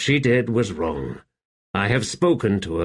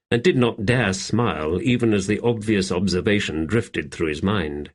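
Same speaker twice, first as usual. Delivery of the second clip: plain reading voice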